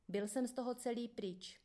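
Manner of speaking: usual speaking voice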